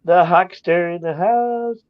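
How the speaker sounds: drawn-out, high-pitched hype greeting; hyped announcement. Real, not acted. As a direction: announce his arrival, stretched out and high. Hyped greeting to chat regular.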